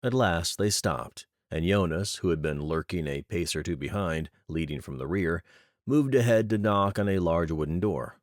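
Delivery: plain reading voice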